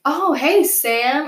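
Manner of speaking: friendly staff greeting. Retold or imitated. School administrators greeting a student they know, friendly. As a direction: imitate an upbeat greeting.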